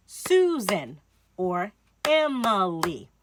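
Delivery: Susan or Emily, slowly, syllable by syllable; syllables spaced out. Shown as a demonstration. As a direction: say each syllable apart, slowly. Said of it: "clap out their syllables".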